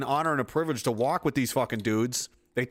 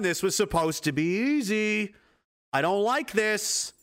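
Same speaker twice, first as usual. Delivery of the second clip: higher, slow mock unease; mock worry; 5 semitones higher, slower than usual. The opponent in round nine, higher and uneasy. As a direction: whine like the boxer losing his edge.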